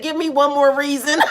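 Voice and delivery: half-laughed warning quote; laughing threat. Retold or imitated. Streamer retells Aaron's threat, laughing. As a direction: say it while laughing. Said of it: "Aaron said".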